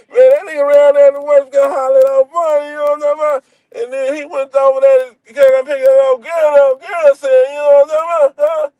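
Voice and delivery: high, rushed mock country chatter; imitating garbled partner. Friend telling a barely intelligible story, imitated in high garbled voice. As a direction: ramble in a put-on high Southern voice, words running together.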